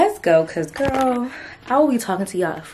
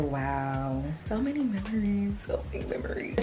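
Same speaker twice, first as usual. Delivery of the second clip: nostalgic hush; 9 dB quieter than usual. Retold or imitated. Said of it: "and I was just like".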